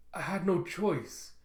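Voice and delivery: lightly acted distressed self-defence; shocked plea. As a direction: plead in mild shock, stretching the words. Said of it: "still in shock from what he did".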